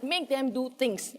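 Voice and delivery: ordinary delivery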